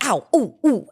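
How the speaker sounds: little mock "ow, ooh" yelps; short reenacted ouches; imitated pained yelps. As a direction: act out hot-footed hopping. Imitates walking barefoot on hot ground.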